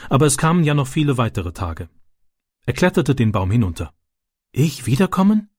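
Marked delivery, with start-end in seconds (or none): 4.5-5.5 higher, tentative rising question; timid little request in a raised pitch; shy question. A narrator's reading. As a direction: ask shyly, voice lifting in hope. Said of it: "fragte er schüchtern".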